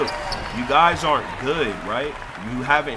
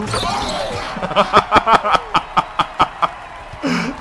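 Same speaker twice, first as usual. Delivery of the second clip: laughing; 6 semitones higher, 3 dB louder than usual. Real, not acted. Laughing at teammate's mom.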